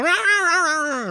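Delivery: high, rapid mock cat screech; imitated cat scream. Owner acting out the cat running from the couch, high mock screaming. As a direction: yowl like a cat fleeing. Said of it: "as he scream-, he's running out".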